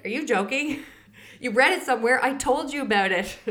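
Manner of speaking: high, loud, incredulous mock scolding; exasperated retort. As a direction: throw it back at him like he's ridiculous. Retelling her annoyed reply to husband.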